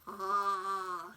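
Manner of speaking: long, low open "ah"; steady drawn-out vowel; dentist "ah". Shown as a demonstration. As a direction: hold it like a patient opening wide. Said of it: "Say ah when you get to the dentist".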